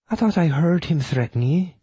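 high, hesitant widow voice; doubtful widow. Acted. Widow recalls a threat against the man, high doubtful voice. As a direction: voice her doubt high, in character.

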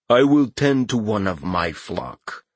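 slow, low, imperious god voice; booming Katashi voice. Acted. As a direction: speak down to a mortal, slowly. Katashi grants help.

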